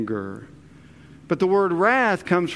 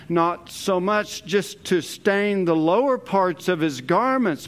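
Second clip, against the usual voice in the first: normal talking voice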